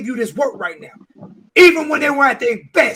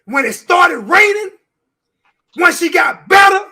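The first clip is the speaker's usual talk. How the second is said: louder, higher heated rant; heated shouting rant about the no-shows; emotional shouting; 5 semitones higher, 5 dB louder than usual. Real, not acted. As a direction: yell it out in anger.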